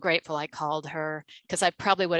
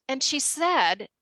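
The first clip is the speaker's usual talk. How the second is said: ordinary talk, nothing marked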